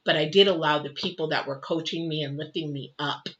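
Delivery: ordinary delivery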